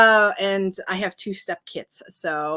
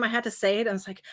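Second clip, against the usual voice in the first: usual speaking voice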